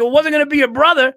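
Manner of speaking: put-on comic performs his old tag line for his host; stand-up punchline. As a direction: fire off the follow-up punch quickly. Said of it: "Can you deliver that the way you delivered it?".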